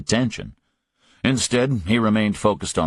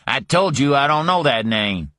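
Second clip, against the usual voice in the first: higher, heated denial; angry denial; 7 semitones higher, 3 dB louder than usual. Acted. Griffin denying to Parker, higher and heated. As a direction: snap back defensively.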